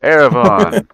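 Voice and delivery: loud, high-pitched proclamation; excited announcement. Acted. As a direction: announce it big to the whole table. Game master announces spell recipient.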